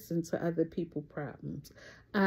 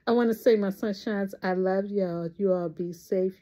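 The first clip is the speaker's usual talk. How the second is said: ordinary talk, nothing marked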